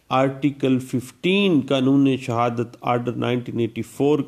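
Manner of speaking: ordinary delivery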